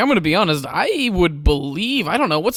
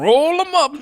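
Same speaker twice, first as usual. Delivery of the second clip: imitating a movie dad; 10 semitones higher, 3 dB louder, slower than usual. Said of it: "and he's like".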